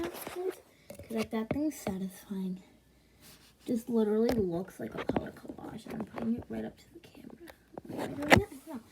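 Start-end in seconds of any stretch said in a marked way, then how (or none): 0.0-7.4 soft ASMR whisper; hushed ASMR aside. Real, not acted. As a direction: whisper close to the mic. Whispering close to slime.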